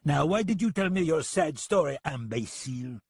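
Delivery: put-on high French-accented taunt; strange French accent. French gunman needles the deputy, taunting French accent. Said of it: "in his strange accent".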